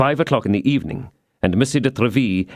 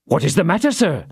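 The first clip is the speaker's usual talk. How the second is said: a touch of alarm, raised pitch; alarmed cry; 6 semitones higher than usual. A narrator's reading. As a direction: ask in sudden worry. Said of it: "cried Planchet".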